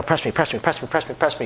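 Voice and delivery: mock nagging player. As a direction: pester the dealer nonstop. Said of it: "Don't just keep blah, blah".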